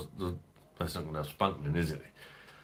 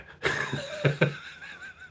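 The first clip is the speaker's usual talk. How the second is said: laugh. Real, not acted. Laughing at the salt pot bit.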